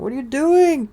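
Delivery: loud, high call to pets. Real, not acted. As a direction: shout over at the pets. Scolding guinea pigs in background.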